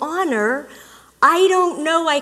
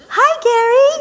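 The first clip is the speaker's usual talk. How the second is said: high, bright sing-song hello; sweet greeting; 10 semitones higher, 6 dB louder than usual. Retold or imitated. Switching ways of being to greet Gary, high and sweet. Another sudden switch of persona.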